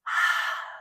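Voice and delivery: open-mouth breathy exhale, fogging a window; Ujjayi breath. Shown as a demonstration. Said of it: "imagine you're fogging up a window".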